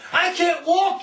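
high whiny mock voice; mocking complaint. Imitating a man who says he can't walk. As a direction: mimic the guy's complaint. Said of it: "he says".

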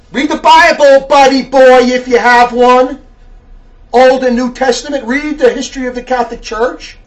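loud, forceful rebuke with hard-hit words; fiery preaching. Real, not acted. Preacher scolding a letter writer over race and faith, fiery rebuke. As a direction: preach it hard at the wayward listener.

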